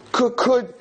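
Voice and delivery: mock timid false start; mock nervous. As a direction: stammer it like an anxious girl. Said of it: "what you're so nervous about".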